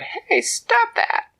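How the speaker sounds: high, loud, slow squeaky protest; tiny ant protesting. A narrator's reading. Ant stuck in ear wax protesting, high squeak. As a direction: snap it at him like an indignant ant. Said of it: "But she said".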